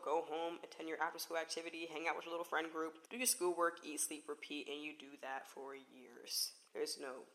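normal talking voice

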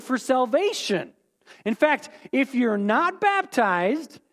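hammed-up hardline believer laying down the law; insistent church voice. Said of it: "and they say".

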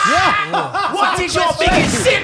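loud, high, slowed hype question; hype shout. Pretend. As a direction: boom the question out. Hyping the big question.

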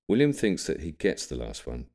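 plain reading voice

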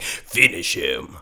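low, slow game announcer; Mortal Kombat. Acted. Explaining the game's catchphrase, low announcer voice. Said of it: "in, like, Mortal Kombat, you just, like".

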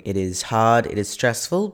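ordinary talk

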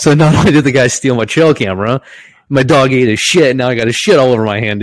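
building outrage and amusement; worked up. Real, not acted. Climax of gross story.

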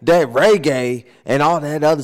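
mock a bossy aunt warning him off reggae; imitating Aunt Marilyn. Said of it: "Aunt Marilyn's like".

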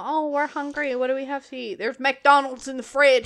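playful imitation of the friend's family; mock hungry family member.

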